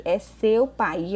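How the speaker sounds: "é seu pai" said very slowly, leaning on "seu"; stressed for contrast. Shown as a demonstration. Teacher contrasting "seu pai" with "você" for students, very slow. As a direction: stretch out "seu pai" to set it against "você". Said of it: "Aqui não é você".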